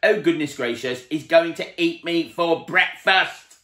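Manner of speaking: high, loud panicked wailing; panicking. A narrator's reading. Mister Jelly sure the tramp is an ogre, loud panicked wail. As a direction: wail about being eaten.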